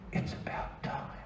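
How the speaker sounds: breathy unvoiced whisper, slow; whispered trailer line. Comic retelling a trailer, whispered slowly. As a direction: hush it like a movie trailer. Trailer graphic voiced dramatically.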